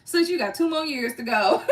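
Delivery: normal talking voice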